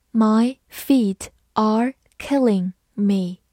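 "My feet are killing me" word by word; slowly, a gap between each word; slow model reading. Shown as a demonstration. Teacher models an idiom for learners, slow model reading.

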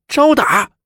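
high, loud threatening yell; nuns' fierce shout. Acted. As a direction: yell it to scare her off. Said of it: "齐声炸道".

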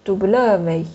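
"W" as dou-BLE-vé, weight in the middle; mis-stressed "W", leaning on the middle syllable. Shown as a demonstration. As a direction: read French "W" stressing "ble". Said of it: "一个放中间".

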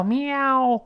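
high, long miaow; cat's meow. Shown as a demonstration. Teacher likening wow's vowel to a cat, meowing. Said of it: "是猫叫".

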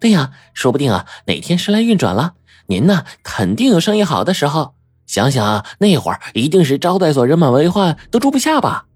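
put-on high, lively girl's voice; young woman chiming in. A narrator's reading. Young guest encouraging the innkeepers about business, high chiming-in voice. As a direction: chime in encouragingly, pitched high. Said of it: "白程程接话说".

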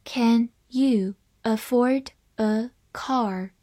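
each word set apart, slowly; slow, deliberate model reading. Shown as a demonstration. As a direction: spell out the question word by word at teaching pace. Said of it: "好，慢慢来读".

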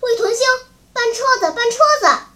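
high, loud urgent call; commanding shout; shouting for someone. A narrator's reading. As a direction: yell orders to a helper. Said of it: "一面喊叫他的通讯员".

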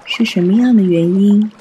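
ordinary talk

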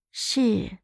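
subdued, detached reply; indifferent. A narrator's reading. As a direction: answer evenly, with little investment. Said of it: "淡淡说道".

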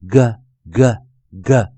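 "G" as a hard "guh", not the letter name; hard G sound. Shown as a demonstration. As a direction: model "G" as three slow "guh" sounds. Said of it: "H 不发音，G念G".

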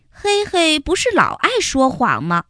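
plain reading voice

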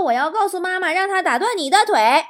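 mock-indignant child warning dad; rising volume, playful threat; tattling child. Child threatens to tell on father.

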